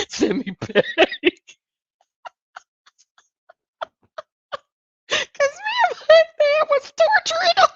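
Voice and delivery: high, giggling through the words; can barely get it out for laughing; real laughter breaking through the story. Real, not acted. Laughing while retelling.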